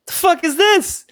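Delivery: high-pitched retold outburst of shock; shocked disbelief. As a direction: act out stunned alarm, pitch shooting way up. Retold shock at a bullet hole.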